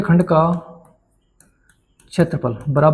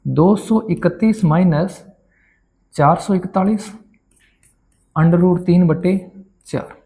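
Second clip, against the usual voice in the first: slow dictation; slower than usual. Shown as a demonstration. Dictating final answer.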